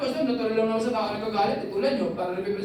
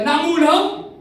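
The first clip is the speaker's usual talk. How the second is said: loud, higher indignant snap; angry retort; 5 semitones higher, 7 dB louder than usual. Retold or imitated. Someone asked a question, answering with loud irritation. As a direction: bristle back defensively. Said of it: "Galit pa eh".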